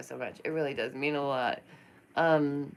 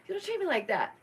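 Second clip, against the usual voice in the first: much higher, a stern put-down; pitched-up stern don't-treat-me-like-that; firm telling-off; 13 semitones higher, faster than usual. Pretend. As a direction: set someone straight with a pitched-up warning. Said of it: "You tell people".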